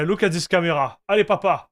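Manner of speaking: ordinary talk, nothing marked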